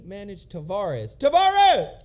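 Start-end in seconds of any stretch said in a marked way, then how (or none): 1.2-1.9 calling out. Pretend. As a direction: belt it like an announcer hyping the crowd. Calling out the band's name.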